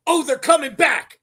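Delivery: imitating an excited fan's inner cheer; retold excitement. Recalling own reaction to her head popping up, excited. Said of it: "I was like, in my head, I'm going".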